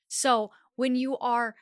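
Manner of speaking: ordinary talk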